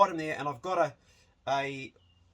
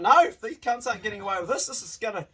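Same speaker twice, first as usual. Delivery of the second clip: defiant shout; 5 semitones higher, 7 dB louder than usual. Retold or imitated. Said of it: "we're like".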